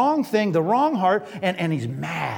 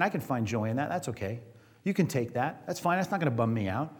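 soft, calm and at peace; calm acceptance; 5 semitones lower, 6 dB quieter than usual. Retold or imitated. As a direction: say it quietly, serenely unbothered. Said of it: "they were saying".

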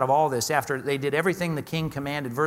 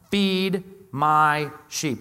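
each word laid down very slowly; measured Jesus voice, every word stretched; slow, weighty command; 3 dB louder, much slower than usual. Acted. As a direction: say it slowly and deliberately, like a gentle command. Jesus' firm reply to Peter.

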